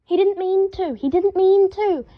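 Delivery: high, loud call from another room; high calling yell. A narrator's reading. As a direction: shout it from the bedroom. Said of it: "Harry yelled from inside".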